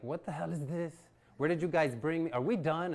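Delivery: can't believe what they're in for; shocked and out of his depth; incredulous disbelief. Retold or imitated. Exhausted after warm-up, incredulous.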